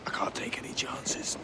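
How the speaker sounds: hushed, teasing aside; sly whisper. Acted. Rackham mocks bound Vane and Kenway in a whisper. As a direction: whisper it like a jest. Mutineer taunts bound captives.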